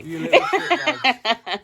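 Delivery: high, slow squeal through laughter; laughing insult. Real, not acted. Laughing at the friends who pranked him, a squealing insult. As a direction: insult them fondly while cracking up.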